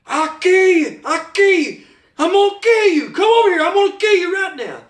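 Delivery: high-pitched acted scream, strained and frantic; retold screaming threat. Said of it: "screaming at the top of my voice".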